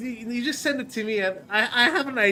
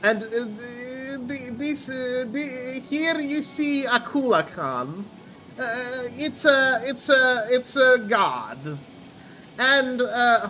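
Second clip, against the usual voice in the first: put-on halting, slow foreign-accented introduction, pitched up; Borat; 2 semitones higher, slower than usual. Friend recording a game parody for the group, Borat impression. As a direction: present each thing haltingly like a mock documentary host. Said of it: "Yeah, I was quoting Borat".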